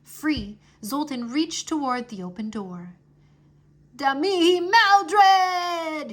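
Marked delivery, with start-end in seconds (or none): 3.9-6.1 very slow, high, loud summoning shout. Acted. Wizard dragon summoning Maldred through the door, drawn-out shout. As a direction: bellow the summons. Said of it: "he shouted".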